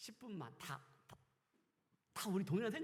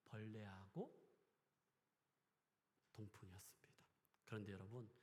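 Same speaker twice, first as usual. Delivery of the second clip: softer, whispered 그런데 여러분; 8 semitones lower, 11 dB quieter than usual. Real, not acted. Preacher drawing the congregation close, whispering. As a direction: hush it to a whisper.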